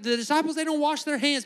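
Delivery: high-pitched, loud mock indignation; mock mad complaint. Preacher mimicking religious people mad at the disciples, high indignant complaint. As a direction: tattle indignantly, pitched well up. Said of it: "they got mad".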